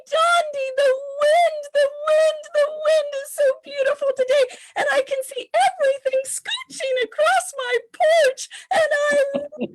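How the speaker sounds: very high, loud, rapturous gushing; mother gushing on the phone, very high and loud; mom in fire voice. Retold or imitated. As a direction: rave about the wind like it's a miracle. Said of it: "my mom called me and she was telling me".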